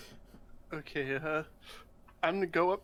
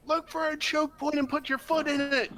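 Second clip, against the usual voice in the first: shouted call to a distant ally; yelling across the room; 6 semitones higher, 4 dB louder than usual. Acted. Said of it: "I'm gonna yell across the room".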